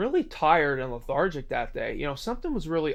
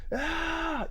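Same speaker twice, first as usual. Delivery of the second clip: short high-pitched cry of pain, softer; imitated yelp of pain, much higher; mock pained scream; 11 semitones higher, 5 dB quieter than usual. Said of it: "his friend's screaming".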